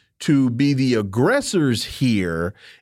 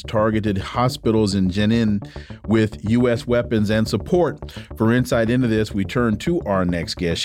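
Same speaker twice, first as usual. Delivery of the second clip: lower, even radio host read; measured on-air introduction, lower in pitch; radio guest introduction; 6 semitones lower, faster than usual. Acted. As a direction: read out the guest's credits in a formal radio manner.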